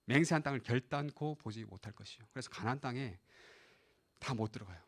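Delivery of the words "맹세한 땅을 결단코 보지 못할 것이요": low, subdued recital of a judgment; God's verdict. Retold or imitated. God barring the disobedient from the promised land, low subdued verdict. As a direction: deliver the oath low and soft. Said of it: "이렇게 말씀하시죠".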